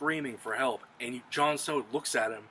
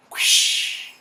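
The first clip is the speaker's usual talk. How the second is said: loud, voiceless rush of air; imitated whoosh; 14 dB louder than usual. All of a sudden Arya flies in, imitated whoosh. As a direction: make a big sudden whooshing noise.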